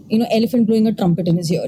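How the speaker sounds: usual speaking voice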